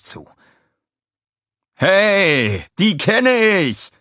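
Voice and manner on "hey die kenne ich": high, loud, slow excited father voice; a high, loud, delighted call to his neighbour; Walter calling. A narrator's reading. Walter calls excitedly to the Grim Reaper. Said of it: "rief Walter".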